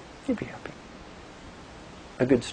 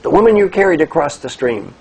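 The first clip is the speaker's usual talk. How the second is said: loud, raised-pitch exasperated scolding; acted angry shout; angry monk; 5 semitones higher, 10 dB louder than usual. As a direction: play an outraged monk spelling it out. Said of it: "The angry monk says".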